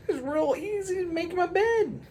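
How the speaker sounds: put-on high, loud boastful kid voice; a proud little boy showing off; kid bragging.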